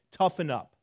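tough-guy shout mocking stoic culture; stern barked orders. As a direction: bark it like a stern coach telling you to man up. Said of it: "It's like".

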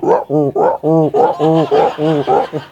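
rhythmic hacking hairball noise; imitated cat retching. Preacher mimics the cat at night, imitated retching. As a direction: heave like a cat about to puke. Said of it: "you hear the cat going".

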